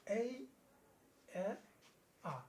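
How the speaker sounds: এই, এ, আ, each set apart; three letter sounds. Shown as a demonstration. Teacher repeating three pronunciations of A for the class, distinctly. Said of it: "কীভাবে?".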